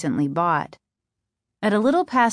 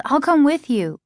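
higher, projected offer; lightly raised calling voice; 6 semitones higher, 4 dB louder than usual. A narrator's reading. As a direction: call ahead to someone leaving. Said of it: "she called".

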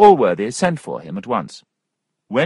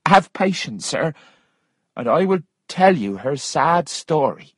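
lightly voiced woman, pitched up; higher, earnest reassurance in character; patient Missus Waters; 7 semitones higher than usual. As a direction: ask for patience in a raised character voice.

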